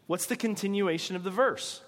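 usual speaking voice

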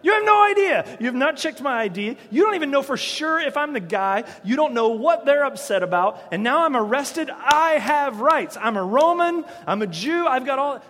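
high, loud indignant rant at the guards; hammed-up indignant prisoner yelling; outraged protest. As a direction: demand your rights, furious. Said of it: "That would be more like me".

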